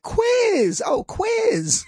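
high, squealed mock realization. Students realizing the word was quiz, high mock exclamation. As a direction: students finally getting it, hammed up. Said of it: "and we're like".

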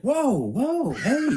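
high, laughing wife voice; imitating his wife with a laugh; mock wife protest. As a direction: play the startled wife, half laughing. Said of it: "she's like".

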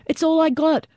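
very high, urgent shout back; yelled back. A narrator's reading. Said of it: "Boland yelled back".

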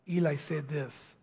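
ordinary talk, nothing marked

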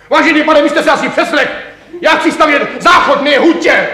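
exasperated rant. Acted. Exasperated tenant rants at official.

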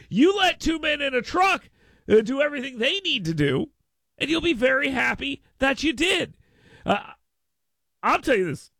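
high-pitched, upbeat radio sponsor read. Real, not acted. Radio host plugging a moving company, enthusiastic ad read. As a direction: sell the sponsor with lifted, lively pitch.

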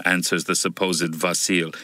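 ordinary delivery

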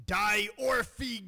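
Die Ohrfeige said in German; German word. Shown as a demonstration. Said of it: "you say".

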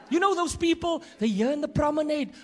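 usual speaking voice

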